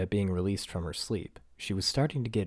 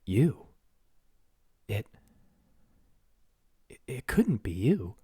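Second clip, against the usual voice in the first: high, slower, halting disbelief; stammering; 4 semitones higher, 4 dB louder, slower than usual. Acted. Princess stammering at the tiny man she holds. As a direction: stumble over the words in shock. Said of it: "she stammered".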